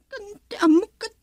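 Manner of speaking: tearful. Acted. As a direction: let the tears break in on the line. Telling a hurtful story to mother.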